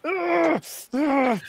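strained high grunts through gritted teeth; pained grunts. A narrator's reading. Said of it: "he is gritting and just goes".

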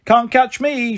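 high teasing sing-song; teasing call. A narrator's reading. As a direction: taunt playfully from above. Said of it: "she called down at me".

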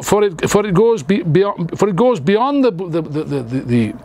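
ordinary talk, nothing marked

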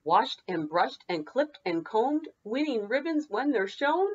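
lively singsong picture-book read; bouncy read-aloud for children. Acted. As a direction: read it like story time for little kids. Teacher reads picture book aloud.

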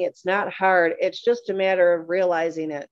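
ordinary talk, nothing marked

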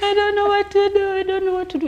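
high mock hysterical laughing; imitated helpless laughter. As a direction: imitate someone falling about laughing, squeaking out the words. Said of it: "your imitation of someone falling off".

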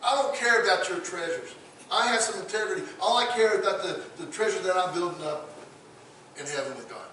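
lightly voiced Daniel refusing the king; defiant Daniel. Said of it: "I can see Daniel looks at him and says".

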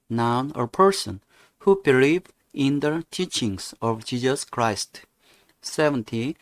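slow, deliberate teacher's model reading; vocabulary read-aloud. Shown as a demonstration. Teacher models Christmas vocabulary for a student, slow and deliberate. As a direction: read each phrase slowly and firmly for learners.

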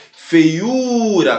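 "Feiura" with a long, strong U; stressed syllable lengthened. Shown as a demonstration. Teacher showing the strongest syllable, "Feiura" with the U stressed and held. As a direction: lean on the U in "Feiura". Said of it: "ele é a sílaba mais forte".

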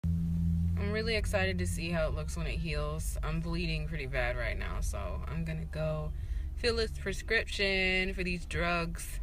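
talking through a numb mouth; numb from the dentist. Real, not acted. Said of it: "my mouth is very numb".

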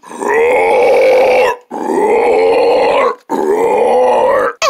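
long, very low growling roars; mock bear roar. Bear scaring the turtles in its forest, menacing. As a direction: roar like a bear to scare them.

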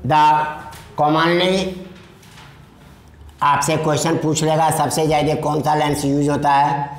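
slow, word-by-word dictation to students. Shown as a demonstration. Teacher dictating notes to class, slow and deliberate. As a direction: spell it out slowly so they can write.

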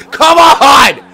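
loud, high furious yelling; raging shout. Real, not acted. Gamer raging at his AI teammates, furious shout. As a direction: tear into your useless teammates.

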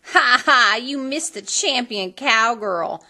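loud mocking laugh-and-taunt; mocking stepsister taunt; jeering. A narrator's reading. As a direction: jeer at her with glee. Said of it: "the older sister jeered".

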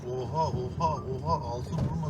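startled gasps as the car jolts; jolted exclamations on rough road; on a bumpy road. Real, not acted. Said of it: "Yunanistan bölgesi direkt yol bozuk".

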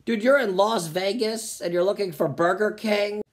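ordinary talk, nothing marked

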